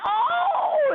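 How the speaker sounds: very high squealed oh of pain, imitated. Patient at a party having kidney point pressed, high pained squeal. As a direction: yelp it out as if it hurts.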